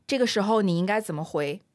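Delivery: normal talking voice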